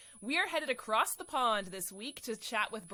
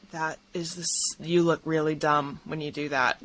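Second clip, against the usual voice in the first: blunt guest remark; 5 semitones lower, 10 dB louder than usual. Retold or imitated. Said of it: "the guests were just like".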